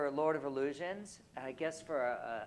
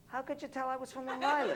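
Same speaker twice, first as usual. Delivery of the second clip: put-on a light Long Island accent, higher and quick with a laugh; imitating Long Island accent; 9 semitones higher, faster than usual. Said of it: "She said".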